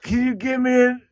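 put-on high, slow whiny baby voice through laughter; high laughing baby voice begging to be fed; mock baby plea. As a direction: whine it like a needy toddler. Mocking friend's nugget request.

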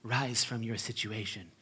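soft, low, tender invitation; a lightly voiced meek Jesus; hushed kindly soothing; gentle Jesus. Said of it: "gentle Jesus".

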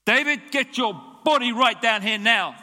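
stern mother yelling upstairs; mother's summons. Retold or imitated. Mother summoning her son, loud stern yell. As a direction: shout it like an angry mum. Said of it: "That's the summoning".

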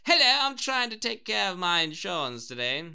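high, loud, hammed-up posh English; cartoony posh British. Mimicking a caller about insurance, loud posh British. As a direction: blare it like a caricatured upper-class Brit. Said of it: "that impression is of, like, essentially the one percent".

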